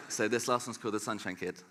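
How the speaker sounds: ordinary delivery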